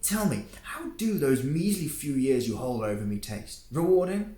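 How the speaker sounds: high, loud mocking taunt. Antoine taunting Male about his age, mocking. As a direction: needle him with sneering questions.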